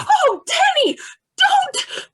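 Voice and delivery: terrified plea. Acted. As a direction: beg in sudden terror. Mother begs him not to.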